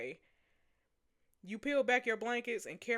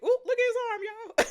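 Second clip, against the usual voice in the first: much higher and louder, laughing; excited squeal; 10 dB louder than usual. Real, not acted. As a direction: squeal with delight at the viewers. Squealing at the arm drape.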